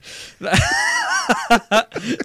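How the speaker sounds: high burst of laughter; big shrieking laugh. Real, not acted. Hosts crack up at joke.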